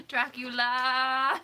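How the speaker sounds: booming mock-spooky name; dramatic Dracula. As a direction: stretch each syllable like a horror count. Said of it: "but can I say it like that?".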